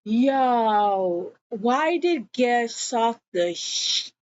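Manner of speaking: normal talking voice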